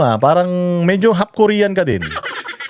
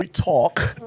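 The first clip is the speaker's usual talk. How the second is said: imitated put-on British vowels, softer; mock British accent; 5 dB quieter than usual. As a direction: parody a fake British accent. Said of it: "naglalagay ng accent sa mga English". (ginagayang pekeng British accent)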